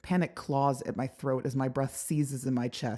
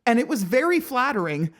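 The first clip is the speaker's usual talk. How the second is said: a hint of a sheepish young man, higher; tentative prince; 10 semitones higher, 8 dB louder than usual. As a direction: try to smooth it over lightly. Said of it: "he tries".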